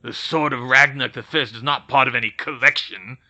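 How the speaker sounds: a lightly voiced goblin, bristling; goblin shooting back. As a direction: snap it back like an angry goblin. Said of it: "the goblin shot back".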